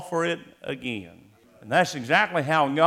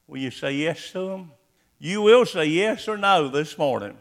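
ordinary talk

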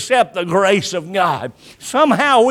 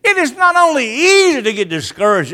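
raised, forceful preaching voice; emphatic restatement; 3 semitones higher, faster than usual. Real, not acted. Preacher going further on discouragement, emphatic restatement. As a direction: drive the point home harder. Repeats the point more forcefully.

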